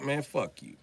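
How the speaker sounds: normal talking voice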